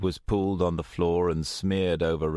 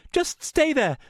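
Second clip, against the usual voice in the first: high, loud, frantic babble; frantic high-pitched reassurance; wild panic; 5 dB louder than usual. A narrator's reading. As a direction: scramble to help, voice high with panic. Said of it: "Rachel looked around wildly".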